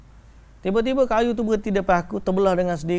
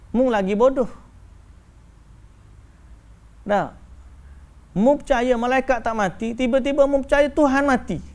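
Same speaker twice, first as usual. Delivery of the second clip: triumphant retort; 7 semitones higher, 3 dB louder than usual. Retold or imitated. Sheikh turns the insult back.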